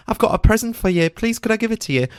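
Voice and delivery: high-pitched imploring offer; retold eager plea. Audience member offering speaker a present, eager plea. Retelling plea to hand over gift.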